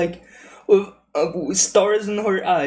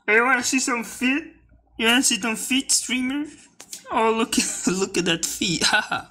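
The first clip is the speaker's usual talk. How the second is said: higher, quick teasing voice offering feet; mock creepy teaser; 8 semitones higher than usual. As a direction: taunt the streamer in a higher sly voice. Mocking creepy chat voice.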